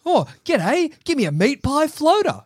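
high, hammed-up Aussie accent; laconic outback drawl. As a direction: overdo the outback twang. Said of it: "mock Aussie voice".

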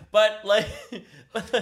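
high laughter through words; laughing. Real, not acted. Laughing while admitting the red hair is fake. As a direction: laugh as you try to talk.

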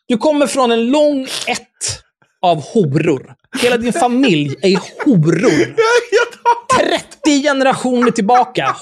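high-pitched, drawn-out mock tirade; hammed-up outrage. Host insulting a letter writer's whole family, hammed-up outrage. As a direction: stretch the insults out in comic indignation.

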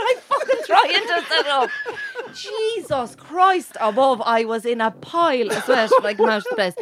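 real laughter breaking into the words; laughing. Real, not acted. Teller recalling trying to sit up, laughing. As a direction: tell it through helpless laughter. Laughing while retelling the ordeal.

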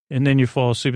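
ordinary delivery

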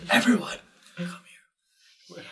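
hushed whisper calling everyone close; "come here" breathed with no voice. Pretend. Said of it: "let me do more of a stage whisper".

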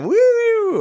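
put-on high excited woo to the kids; playful woo. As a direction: whoop it up playfully. Playing loudly with kids.

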